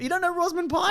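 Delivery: high, disbelieving question; can't believe he doesn't know her; incredulous. Real, not acted. Host teasing co-host who doesn't know Rosamund Pike, incredulous.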